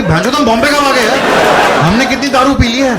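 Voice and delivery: high, loud tipsy panic; a drunk man suddenly lost and alarmed; panicky drunk. Retold or imitated. Drunk guy in the audience panicking, high and loud. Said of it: "वो tension में आ गया".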